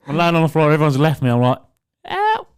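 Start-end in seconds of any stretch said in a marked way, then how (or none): 2.0-2.5 high, hammed-up call for help; stranded kid calling out, higher and hammed up; mock cry for help. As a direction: wail for help, half laughing. Left behind after falling.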